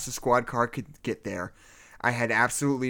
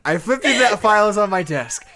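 high, loud hammed-up narrator voice; mock dramatic reading; 9 semitones higher, 8 dB louder than usual. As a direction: ham up the narration. Rereads line in mock voice.

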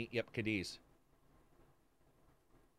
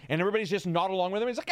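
ordinary talk

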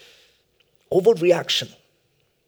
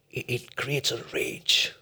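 whisper; 3 semitones lower, 7 dB quieter, slower than usual. Acted. Sermon dramatic drop.

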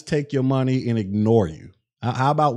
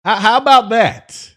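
heated rant; 5 semitones higher than usual. Real, not acted. Ranting at rude donor.

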